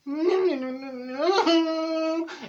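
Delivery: long, high wordless laugh; drawn-out chuckle. Acted. Comic at a loss about cheering friend, high drawn-out laugh. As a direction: chuckle through it and let it trail. Laughs at her own doubt.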